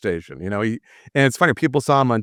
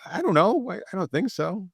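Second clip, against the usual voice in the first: unsure reply; 9 semitones higher, 4 dB quieter than usual. Retold or imitated. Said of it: "I'm like".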